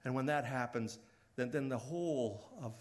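ordinary talk

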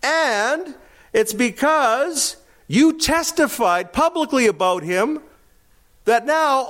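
butter John up, leaning on "you"; flattering disciples. Acted. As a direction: disciples pleading with John about his standing. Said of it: "Can you imagine somebody saying that?".

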